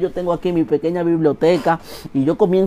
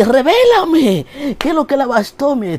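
high, stretched begging; eager pleading; 11 semitones higher, 3 dB louder than usual. Acted. Comedian begs God to reveal it, high and pleading.

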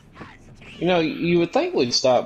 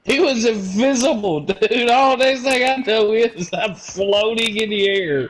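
higher and louder, worked up; excited; 8 semitones higher, 3 dB louder than usual. Real, not acted. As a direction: blurt it out, still rattled. Retelling the sudden ambush.

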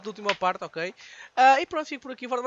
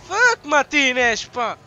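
loud, slow drawn-out curse at the player; angry curse; 2 semitones higher, 8 dB louder, slower than usual. Real, not acted. Cursing at his player.

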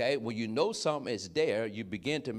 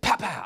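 loud, high "pow, pow"; imitated lightning crack; 6 semitones higher, 7 dB louder than usual. Storm answers the man who called it, imitated lightning crack. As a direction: make the thunderclap with your voice. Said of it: "And the light said".

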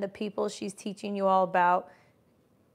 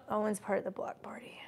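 unvoiced whisper to herself; whispered aside; 12 dB quieter than usual. Real, not acted. Blocking a rude viewer on the live, whispered. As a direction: whisper it while blocking a troll.

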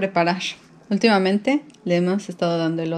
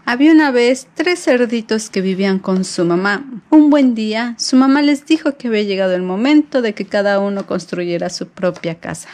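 put-on reading to a child; 5 dB louder than usual. Reads story to baby.

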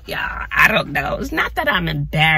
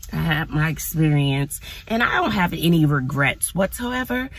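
ordinary talk

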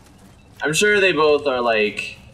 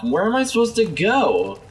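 ordinary talk

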